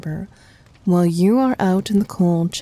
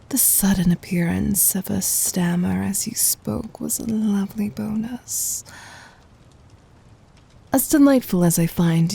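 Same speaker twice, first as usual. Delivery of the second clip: whisper. Acted. Tender intimate reassurance.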